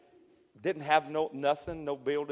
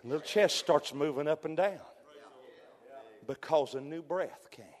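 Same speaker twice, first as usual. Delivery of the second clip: hushed storytelling. Acted. Tender retelling of Elijah story.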